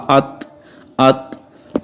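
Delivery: ات with a breathy puff after the t; ات ending in a light th breath; soft aspirated t. Shown as a demonstration. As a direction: let air escape after ات. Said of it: "آواز آہستہ آہستہ پست ہوگی".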